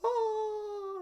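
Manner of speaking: high, loud, wailing falsetto; exaggerated shrieking fretful wail; mock panicking mum. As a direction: panic like a hammed-up worried mum. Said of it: "my mum started panicking".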